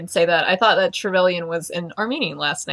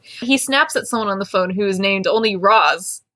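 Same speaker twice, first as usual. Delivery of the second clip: usual speaking voice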